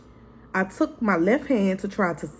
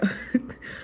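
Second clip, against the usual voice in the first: quiet wheezing giggle; a soft airy snicker; breathless laugh; 6 dB quieter than usual. Real, not acted. Telling viewers a funny hospital moment, soft breathy laugh. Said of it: "Let me make y'all laugh".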